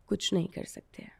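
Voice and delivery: a father's hopeless whisper; defeated whisper. Retold or imitated. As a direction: whisper it, giving up. Father resigns, poor family.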